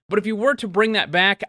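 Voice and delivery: ordinary talk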